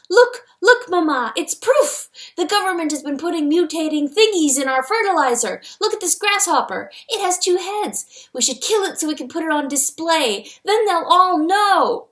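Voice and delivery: put-on high, loud, eager child voice; excited little boy. A narrator's reading. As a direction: burst out with your big discovery. Excited boy showing mother his proof.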